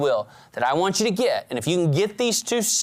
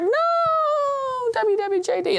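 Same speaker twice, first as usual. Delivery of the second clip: squeaky frog plea. Acted. Little frog pleading while swallowed.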